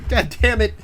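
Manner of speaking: loud, high, laughing disbelief at yourself; exasperated laughing. Real, not acted. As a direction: cry out through laughter, exasperated at your own flop. Exasperated at own failed accent.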